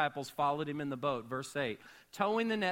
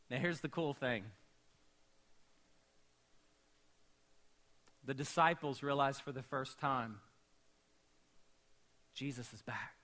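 hushed preaching; 5 semitones lower, 7 dB quieter than usual. Real, not acted. Quiet intimate sermon build.

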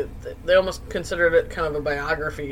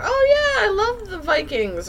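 gushing excitement. Retold or imitated.

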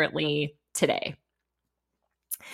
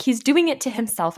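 louder, higher exasperated outburst; mock yell, quicker and defensive; retold yell; 6 semitones higher, 5 dB louder than usual. Young analyst snapping back at a mom, retold yell.

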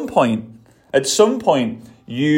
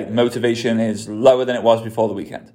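ordinary delivery

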